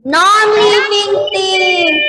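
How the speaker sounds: high, drawn-out yell; yelled answer. Real, not acted. Pupil calls out an answer to the teacher, yelling. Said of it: "no yelling ya".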